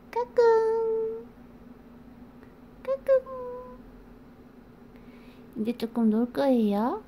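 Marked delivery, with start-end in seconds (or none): 0.1-1.3 peekaboo. Real, not acted. Peekaboo to baby. 2.8-3.8 peekaboo. Real, not acted. Peekaboo to baby.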